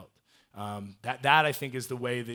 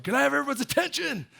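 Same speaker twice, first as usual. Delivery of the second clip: much higher, loud call across the room; calling for attention; 8 dB louder than usual. Retold or imitated. Speaker addressing everyone in his gym, loud high call. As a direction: call the whole gym to stop and listen. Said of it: "and I was like".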